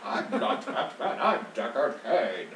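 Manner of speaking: hammed-up old game character voice, slow and drawn out; Deckard Cain. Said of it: "bust out the Deckard Cain voice".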